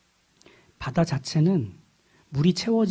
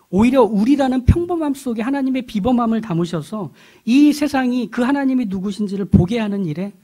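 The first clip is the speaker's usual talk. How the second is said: raised, forceful sermon voice; impassioned preaching; 6 semitones higher, 6 dB louder than usual. Real, not acted. Preacher telling congregation why God saves, impassioned preaching. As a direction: preach it high and loud, driving each phrase.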